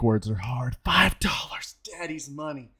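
breathy whispered aside. Real, not acted. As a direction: drop to a whisper mid-hype.

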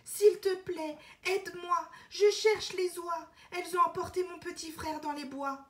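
put-on urgent little-girl plea; pleading girl. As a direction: beg like a frightened girl. Elena begs the river.

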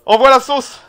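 cri joyeux, plus fort et plus aigu; lancement enthousiaste. Real, not acted. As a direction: donner le coup d'envoi avec entrain. Lance son invité à l'antenne. (l'animateur lance l'exposé à pleine voix)

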